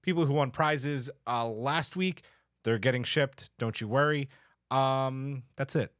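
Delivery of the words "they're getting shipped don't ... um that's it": ordinary delivery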